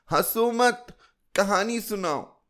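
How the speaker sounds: put-on a child's high demand; bossy child. A narrator's reading. Chilki tells the storyteller to stop laughing, high bossy child.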